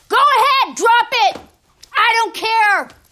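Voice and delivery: loud, high yell; full-voiced outburst, last words drawn out; defiant shout. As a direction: scream the dare with abandon. Despairing dare to drop the bomb.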